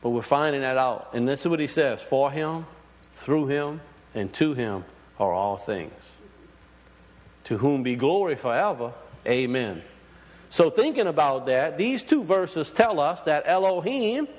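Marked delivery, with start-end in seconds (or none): 2.0-9.8 hushed, lower retelling of the scripture. Acted. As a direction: let the verse settle gently, almost to yourself. Quotes the verse again.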